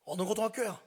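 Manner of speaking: loud, high interrogation; forceful questioning yell; shouted demand. Retold or imitated. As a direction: shout like an authority demanding answers. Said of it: "얘들한테 제가 소리쳤어요".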